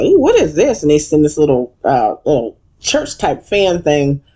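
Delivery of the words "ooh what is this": high, curious "ooh, what is this"; playing the puzzled neighbors at the door; mock surprise. Neighbors opening the butler's invitation, high puzzled voice. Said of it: "We're like".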